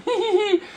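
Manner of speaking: high-pitched mock giggle; imitated sneaky giggle. Kid runs off with the money, mock gleeful giggle. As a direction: giggle like a kid getting away with it. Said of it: "runs off with his friend going".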